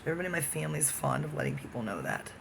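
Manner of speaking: ordinary talk, nothing marked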